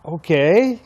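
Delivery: hesitant okay. Retold or imitated. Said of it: "I said".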